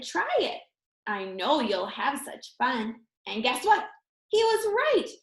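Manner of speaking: usual speaking voice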